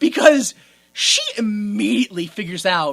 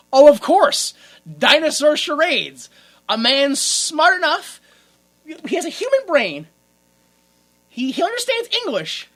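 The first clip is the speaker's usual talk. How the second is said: pitched-up sarcastic wonder; mock amazement; 6 semitones higher, 4 dB louder than usual. Sarcastic exclaiming at absurd plot.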